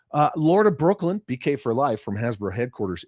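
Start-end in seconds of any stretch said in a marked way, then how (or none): none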